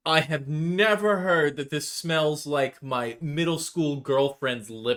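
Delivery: loud, slow, incredulous; playfully scandalized disbelief; mock outrage. Real, not acted. Mock outrage at partner's description.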